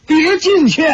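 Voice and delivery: high-pitched, urgent call; hasty warning shout. A narrator's reading. Shi Potian warning the crowd of danger inside, hasty shout. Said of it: "石破天连忙大叫".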